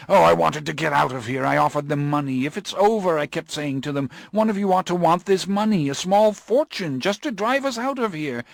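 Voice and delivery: a man begging to flee; frantic Morgan. Acted. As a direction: Morgan desperate to escape. Morgan recalls bribing drivers.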